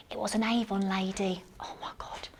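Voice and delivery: hushed confiding whisper; a secret told to the audience. Real, not acted. Whispering a confession.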